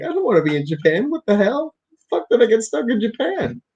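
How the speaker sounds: high, fast, tearful whine; mock crying wail. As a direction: wail like a stranded kid. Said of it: "I was, like, crying and shit".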